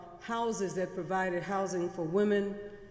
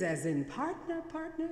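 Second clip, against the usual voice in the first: incredulous question; 8 semitones higher, 4 dB quieter than usual. Retold or imitated. Man questioning, disbelieving.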